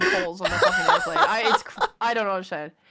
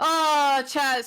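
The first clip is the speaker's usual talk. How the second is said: loud, higher theatrical lament; mock dismay; 6 semitones higher, 6 dB louder than usual. Streamer to chat about abandoned stream, hammed-up dismay. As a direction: wail to the audience in mock betrayal.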